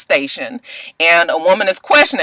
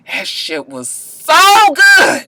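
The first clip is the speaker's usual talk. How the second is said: slow emphatic rave; 7 semitones higher, much slower than usual. Real, not acted. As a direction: stretch every word in delight. Raving about the show.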